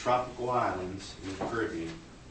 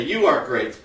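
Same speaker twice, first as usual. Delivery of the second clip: loud, raised call; louder; 8 dB louder than usual. Shown as a demonstration. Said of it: "even louder".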